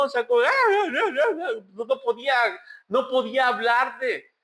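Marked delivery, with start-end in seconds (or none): 0.4-1.5 much higher, hammed-up broken "ah ah" sobs; mock childish sobbing. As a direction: imitate a little girl choking on her crying. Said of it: "la niña tenía un llanto chilindrinesco". (llanto infantil exagerado, de burla)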